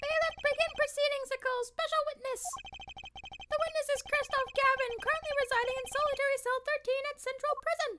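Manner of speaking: very high-pitched judge, announcing a ruling; deliberating judge. Acted. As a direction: pronounce it from the bench in a high comic voice. Voices the judge in a courtroom game.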